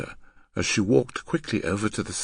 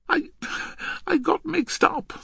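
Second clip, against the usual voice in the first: high-pitched, faltering excuse; apologetic girl. Acted. Girl excusing her mistake to the man, apologetic.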